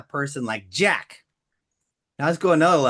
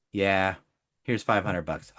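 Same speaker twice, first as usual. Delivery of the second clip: resigned buyer; 9 semitones lower, 4 dB quieter than usual. Acted. Voices humble car buyer replying.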